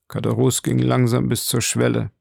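ordinary delivery

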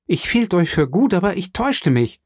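höher gesprochene, gekränkte junge Frau; enttäuschte Mercedes. A narrator's reading. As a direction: als enttäuschtes Mädchen, deutlich höher. Mercedes enttäuscht von Fernand. (Mercedes wirft Fernand seine Eifersucht vor, gekränkt)

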